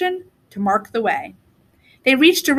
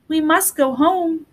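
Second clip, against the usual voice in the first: put-on slow, high little-girl voice; a worried girl urging her brother home; small child voice; 4 semitones higher, slower than usual. Gretel urges her brother to go home, earnest and slow.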